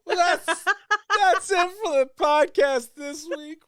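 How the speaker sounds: high, loud, laughing through the words. Real, not acted. Host wrapping up the podcast while laughing hard. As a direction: crack up while signing off. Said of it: "laugh cry".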